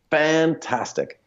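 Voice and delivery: slow, high, drawn-out mock enthusiasm; sarcastic fantastic. Telling about the seller's slow reply, sarcastic drawl. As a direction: stretch it out, dripping with irony. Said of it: "I was like".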